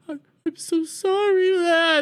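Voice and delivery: very high, whiny fake sob; mock crying. Mother apologizing to her lost daughter, mock crying. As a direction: wail an apology in a squeaky mock cry. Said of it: "they both start crying".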